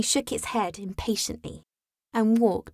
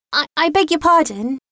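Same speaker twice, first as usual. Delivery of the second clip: higher, faltering apology; very humble; 6 semitones higher, 4 dB louder than usual. A narrator's reading. Alice apologising to the mouse, humble. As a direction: apologise meekly, stumbling at the start. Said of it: "said Alice very humbly".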